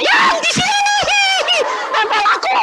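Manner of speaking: wife yelling that the demon is here, half laughing; wife screaming. Retold or imitated. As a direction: squeal it out like the panicked wife. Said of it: "istri saya teriak-teriak".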